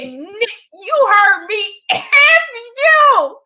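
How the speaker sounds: high, loud, slow shout through laughter and tears; defiant shout. Retold or imitated. Comic retelling her comeback to the host, teary shout. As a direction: fling it back at the host, half laughing, half crying. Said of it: "I said".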